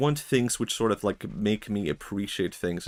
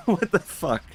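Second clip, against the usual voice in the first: high, louder incredulous cry, half laughing; mock outrage breaking into a laugh; 8 semitones higher, 3 dB louder than usual. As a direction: laugh it out in disbelief, pitched way up. King fails, repeats louder, laughing.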